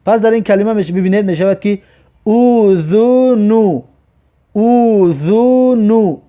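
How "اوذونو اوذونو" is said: slow, long held "oo" vowels; drawn-out model reading. Shown as a demonstration. Teacher shows learners the damma sound, slow and drawn out. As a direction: stretch every vowel of "اوذونو". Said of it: "ضمه که دارد همش او".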